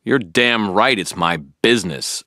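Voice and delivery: spat retort. A narrator's reading. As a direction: bite out each word. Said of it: "I spat".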